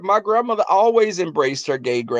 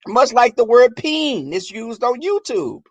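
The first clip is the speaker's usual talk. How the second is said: ordinary talk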